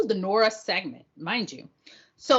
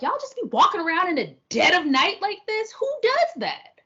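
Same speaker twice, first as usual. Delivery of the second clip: much higher, slowing, disbelieving; incredulous; 10 semitones higher than usual. Retold or imitated. Reacting to night walkers, high and disbelieving. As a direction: ask it like it makes no sense.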